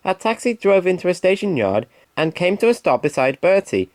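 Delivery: ordinary delivery, nothing marked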